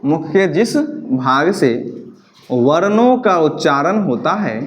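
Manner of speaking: slow, spaced out for note taking; teacher dictating a definition to write down; slow dictation. Shown as a demonstration. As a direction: dictate phrase by phrase, slowly. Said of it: "जिसका हम definition लिख लेंगे बच्चा".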